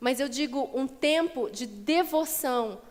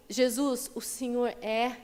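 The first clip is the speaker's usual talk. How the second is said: tender devotion; slower than usual. Shown as a demonstration. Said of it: "de falar assim".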